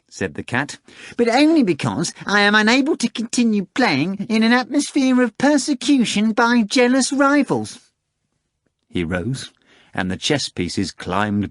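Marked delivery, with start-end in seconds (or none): 1.2-7.7 much higher, louder, self-assured cat voice; a smug cat boasting it will win; high showy talking cat, sure of victory; pompous cat. Acted. Cat Behemoth's voice.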